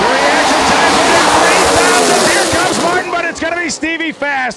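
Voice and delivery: loud, high race finish call; excited race call. Real, not acted. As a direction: shout the finish as it happens.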